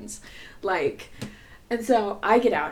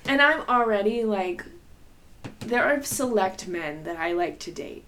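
usual speaking voice

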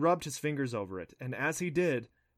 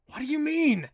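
surprised; 9 dB louder than usual. Acted. Adam asks, alarmed.

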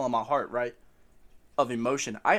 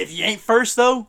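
big booming quote, pitched up; loud movie quote; 8 semitones higher, 13 dB louder, slower than usual. Retold or imitated. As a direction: belt it like a famous line. Quoting a famous racing line.

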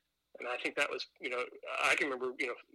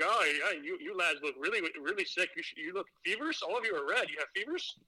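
higher-pitched, deliberately bad Scottish accent; terrible Scottish accent; 5 semitones higher than usual. Teacher asking sunburnt students about fevers, bad Scottish accent. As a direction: imitate a Scottish teacher, badly. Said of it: "do the worst Scottish accent ever".

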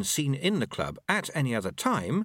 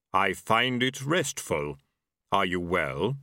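slow, low reaper voice; unhurried Death, every word weighed; grim reaper voice; 3 semitones lower, slower than usual. A narrator's reading.

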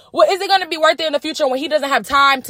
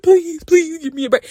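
higher-pitched pretend pleading; mock begging; 7 semitones higher than usual. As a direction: beg for mercy in a put-on voice. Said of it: "I know he's begging for a break".

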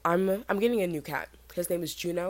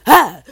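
short, very loud, higher yelp; shocked yell; 5 semitones higher, 17 dB louder than usual. Acted. Hearing the freshman is only eighteen, loud shocked yell. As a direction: burst out with a shocked cry.

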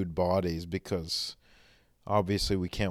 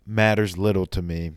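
normal talking voice

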